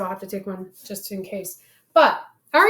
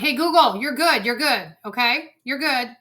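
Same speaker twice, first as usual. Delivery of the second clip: higher, louder, hushing the speaker; calm the speaker down, louder; louder soothing; 3 semitones higher, 7 dB louder than usual. Real, not acted. Soothing interrupting smart speaker.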